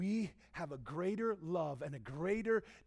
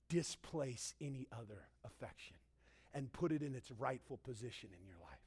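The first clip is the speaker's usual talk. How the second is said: the Father speaking tenderly; 4 semitones lower, 6 dB quieter than usual. Acted. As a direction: speak as a gentle father inviting close. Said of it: "He's saying".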